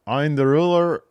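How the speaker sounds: loud, high catchphrase announcement; Obafemi. Acted. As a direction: proclaim it like a wrestler's catchphrase. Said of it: "whatever Obafemi calls himself".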